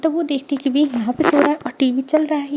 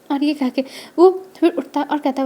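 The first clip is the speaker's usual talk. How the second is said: ordinary delivery, nothing marked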